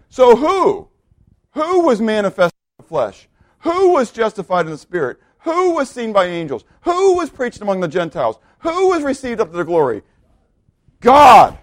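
high, ringing rhetorical questions building to a loud one-word answer; emphatic preaching. Real, not acted. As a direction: hammer each question, then burst out the answer.

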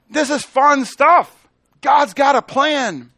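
high, loud, drawn-out proclamation; exultant preaching. Real, not acted. Preacher delighting in God's plan, proclaiming loud and slow. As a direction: proclaim it slowly at full voice. Exclaims to the congregation.

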